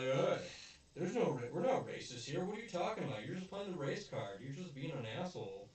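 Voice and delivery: imitating the rich guy scoffing; mock indignant rich guy. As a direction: brush him off like an offended rich man. Rich guy dismisses race card, retold.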